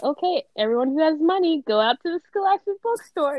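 high-pitched classroom announcement; imitated teacher announcing. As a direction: address the whole class like a chipper teacher. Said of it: "the teacher would be like".

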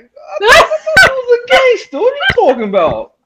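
loud, very high squawk of disbelief; mock disbelief. Guest retelling his reply to cuddling gangster girl, squawking. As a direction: shoot way up high and loud, incredulous.

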